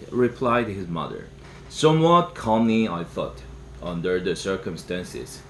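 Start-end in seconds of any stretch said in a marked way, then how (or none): none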